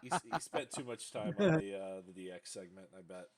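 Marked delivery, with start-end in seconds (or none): none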